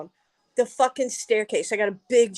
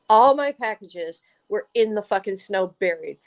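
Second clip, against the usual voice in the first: ordinary delivery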